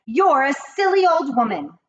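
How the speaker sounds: put-on a snooty wife shooing the old woman off; haughty dismissal. A narrator's reading. Mrs Jenkins insulting.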